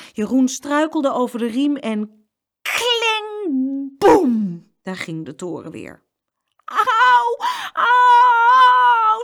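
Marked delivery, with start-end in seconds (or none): 2.6-4.7 high ringing clang, loud boom; crash noises. A narrator's reading. Narrator imitates the tower falling again, loud crash. 6.6-9.2 very high, loud howl; long-drawn yell of pain; screaming in pain. A narrator's reading. Jeroen under the falling cans, screaming in pain. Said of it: "schreeuwde Jeroen".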